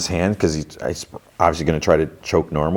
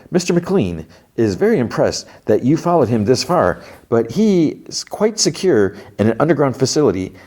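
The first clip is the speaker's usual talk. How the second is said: higher, oily salesman patter; snake oil salesman; 6 semitones higher than usual. Retold or imitated. Salesman delivering McLean's message, higher salesman voice. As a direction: pitch it like a sly salesman. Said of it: "So it's a snake oil salesman".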